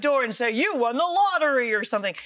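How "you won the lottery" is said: high, loud mock prize-winner announcement; playful, pitched-up proclamation; excited prize announcement. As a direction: announce a jackpot win, hammed-up. Imagined visitor announcing a prize.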